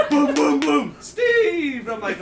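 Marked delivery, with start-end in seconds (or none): 0.1-1.0 loud, high "boom" knocks; pounding noise. Retold or imitated. Man acting out his friend hammering on the door, booming loudly. 1.0-1.9 high, loud, drawn-out shout of a name; drunken yell. Retold or imitated. Man mimicking his drunk friend outside the bedroom, shouting.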